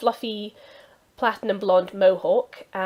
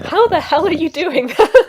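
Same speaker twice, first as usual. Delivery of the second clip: loud, high disbelieving shout; amazed outburst; 10 semitones higher, 11 dB louder than usual. Real, not acted. Player stunned by the cat sounds, shouting in amazement. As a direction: blurt it out in sheer amazement.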